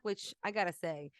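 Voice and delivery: ordinary talk, nothing marked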